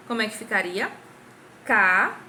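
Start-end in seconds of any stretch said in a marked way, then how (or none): 1.6-2.2 Ca said loud and alone; Ca isolated with a clap; first clapped syllable. Shown as a demonstration. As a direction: punch the single syllable Ca. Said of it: "que foi a primeira palminha".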